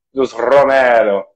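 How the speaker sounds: ordinary delivery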